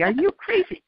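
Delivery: mock aggressive challenge. Gunmen questioning a lone pilgrim, mock aggressive. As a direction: imitate their challenge, not really angry. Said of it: "they were trying to be aggressive".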